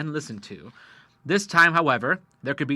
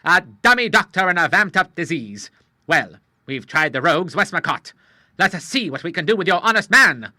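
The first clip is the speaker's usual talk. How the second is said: louder, outraged sea captain; indignant; 4 semitones higher, 9 dB louder than usual. A narrator's reading. The Admiral finding the fake doctor, a mild indignant cry. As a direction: call out the fraud.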